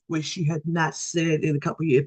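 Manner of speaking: normal talking voice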